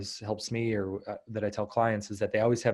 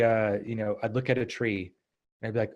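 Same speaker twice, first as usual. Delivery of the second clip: usual speaking voice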